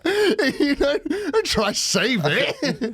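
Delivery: high, slow laughing tease. Real, not acted. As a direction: let the laugh carry the jab. Still laughing, teasing.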